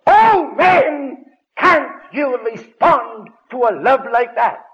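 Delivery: high, loud, slow appeal; impassioned shout. Retold or imitated. Preacher retelling his altar call to the congregation, shouted. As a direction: cry out to the men in the pews. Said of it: "I said".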